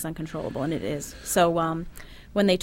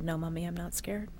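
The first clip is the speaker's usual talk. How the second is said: put-on much softer, hushed child's answer; daughter's reply; 10 dB quieter than usual. Mother retelling her daughter's last words, softly. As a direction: answer calmly, barely above a breath.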